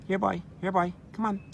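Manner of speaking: high coaxing call; calling like a dog. Real, not acted. Woman calls the duck like a dog, high. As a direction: coax it like a dog.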